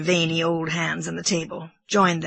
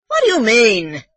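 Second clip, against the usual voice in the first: loud, raised, alarmed demand; sudden shout at the housekeeper; 6 semitones higher, 9 dB louder than usual. Acted. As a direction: blurt it out, unable to hold back. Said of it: "He was shouting".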